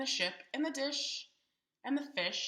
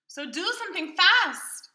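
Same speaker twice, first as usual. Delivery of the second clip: higher, louder urging; urgent fish; 8 semitones higher, 8 dB louder than usual. Acted. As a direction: press them to hurry. Fish urges in story.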